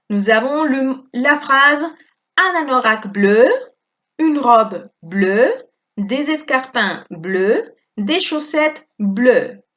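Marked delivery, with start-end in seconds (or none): 2.4-9.6 slow model phrases, "bleu" always said the same; French pronunciation demo. Shown as a demonstration. Teacher modelling "bleu" phrases for the class, slow and clear. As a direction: drill it slowly, each "bleu" identical. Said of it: "Aquí también pronunciamos todo igual".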